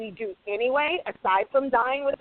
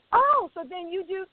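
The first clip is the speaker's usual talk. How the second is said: high, lightly voiced executive asking; high mock question from a meeting; mock puzzled executive; 8 semitones higher than usual. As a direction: play a puzzled exec checking. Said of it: "And I'm like".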